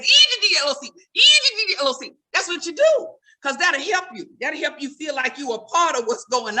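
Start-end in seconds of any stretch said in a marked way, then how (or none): 0.0-2.1 quick, higher, louder spelled chant; said fast. Shown as a demonstration. Fast and loud, to fit in at church. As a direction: rattle it off fast. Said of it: "but you gotta say it fast".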